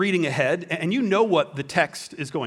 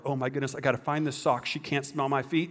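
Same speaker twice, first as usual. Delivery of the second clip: hushed panic; 4 semitones lower, 7 dB quieter than usual. Retold or imitated.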